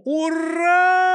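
high loud hurrah; crowd cheering. A narrator's reading. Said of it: "кричали горожане".

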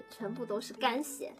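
usual speaking voice

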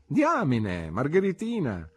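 high, drawn-out teasing exclamation; exclaiming. A narrator's reading. Friend greeting the narrator on entering his rooms, exclaiming. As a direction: exclaim with lively swoops in pitch. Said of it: "esclamò".

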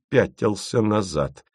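plain reading voice

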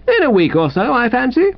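high, breezy, unbothered answer; airy reply. Acted. Invalid rival telling Wooster how long he stays, airy.

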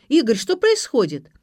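high, loud demand; alarmed yell, acted by the narrator; shouting. A narrator's reading. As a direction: cry out for an explanation. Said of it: "закричала Ксюша".

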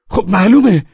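high, loud snap of a shout. Acted. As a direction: yell it back at him in frustration.

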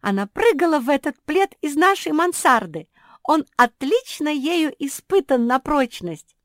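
high, loud exultant cry; jubilant. A narrator's reading. Жози celebrating the safe catch, jubilant. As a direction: exult at full voice. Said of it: "ликовала Жози".

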